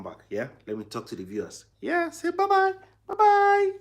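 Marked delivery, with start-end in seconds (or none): 1.8-3.7 very high, loud squeaky bye-bye; squeaky high bye-bye for the son. Real, not acted. Baby waves bye.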